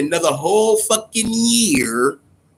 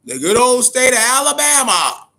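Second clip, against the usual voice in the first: high, loud mock praise for Alabama; sarcastic; 9 semitones higher, 4 dB louder than usual. Real, not acted. Scoffing at Alabama's covid parties, high and loud sarcasm. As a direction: sneer it out with fake pride.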